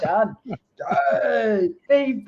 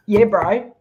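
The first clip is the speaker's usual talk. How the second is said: a louder, jokey New Zealand "Yeah, bro"; mock Kiwi accent; 3 semitones higher, 7 dB louder than usual. Friend jokingly trying the Kiwi accent she just gave up on. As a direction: toss off a hammed-up Kiwi "bro". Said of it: "I can't do the New Zealand accent".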